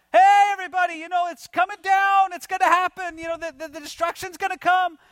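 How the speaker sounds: high, loud mock street-prophet holler; hammed-up doomsday shouting; crying out. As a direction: imitate a crazy guy shouting warnings to a crowd. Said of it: "a crazy guy crying out on the Temple Mount".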